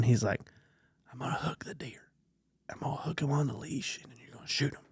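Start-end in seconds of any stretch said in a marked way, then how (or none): 1.1-4.8 put-on softer conspiratorial kid's whisper. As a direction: whisper the sneaky plan like a kid. Son's secret plan retold.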